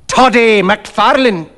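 high-pitched herald's announcement; somewhat loud hail. A narrator's reading. Fettes repeats his hail to the startled doctor, loudly. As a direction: repeat the name as a pointed call. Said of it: "repeated Fettes".

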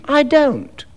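high-pitched, offhand denial; a touch of cool indifference; coolly. A narrator's reading. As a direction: state it coolly, pitch lifted. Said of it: "he added coolly".

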